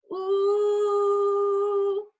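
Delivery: tight, pressed ooh, high and loud; pressed, strained vocal tone. Shown as a demonstration. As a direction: squeeze the sound with tension. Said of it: "There's a pressed sound".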